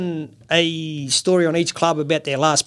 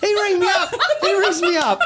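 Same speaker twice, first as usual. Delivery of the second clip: high, laughing through the words; 11 semitones higher than usual. Real, not acted. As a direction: giggle it out while telling. Laughing while telling story.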